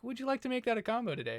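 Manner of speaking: high, quick cashier upsell; chirpy fast-food cashier pitch. Retold or imitated. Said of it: "and they were like".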